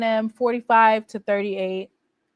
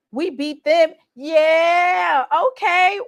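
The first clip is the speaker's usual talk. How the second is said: shouted, higher and louder yeah okay; excited shout; 6 semitones higher, 4 dB louder, slower than usual. Real, not acted. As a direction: crow over the win. Cheering a rivalry win.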